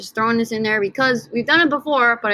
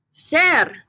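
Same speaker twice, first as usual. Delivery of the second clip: ordinary talk, nothing marked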